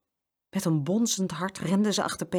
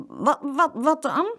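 high, stammering squeak; timid boy's piping question; squeaky voice; 9 semitones higher, 4 dB louder, slower than usual. A narrator's reading. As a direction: squeak it out nervously. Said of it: "vroeg Frans met een piepstem".

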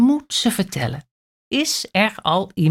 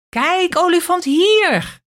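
much higher, louder excited call; 11 semitones higher, 4 dB louder than usual. A narrator's reading. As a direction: show off the elephant. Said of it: "roept kleine aap". (Kleine Aap roept opgewonden)

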